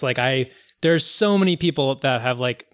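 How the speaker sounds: normal talking voice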